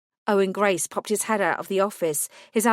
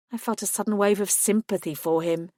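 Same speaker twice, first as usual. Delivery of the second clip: ordinary delivery, nothing marked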